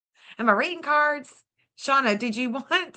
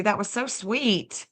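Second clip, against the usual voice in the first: ordinary talk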